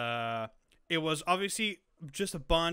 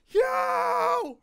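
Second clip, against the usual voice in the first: high, loud cheering yell; reenacted audience roar; retold scream; 8 dB louder than usual. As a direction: whoop like an ecstatic theater crowd. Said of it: "and I was screaming".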